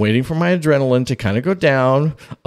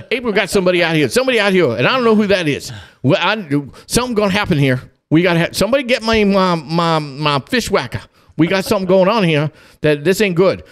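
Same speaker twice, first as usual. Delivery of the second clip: retold alarm; 6 semitones higher, much faster than usual. Dad alarmed by intruder.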